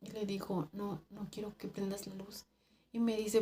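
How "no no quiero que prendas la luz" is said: very soft, small-voiced plea; hushed refusal. Worker begging coworker to leave lights off, hushed. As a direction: say it barely above a murmur.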